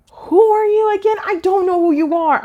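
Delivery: high, puzzled and lost; baffled disbelief. Retold or imitated. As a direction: sound like you never met her. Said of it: "it was like".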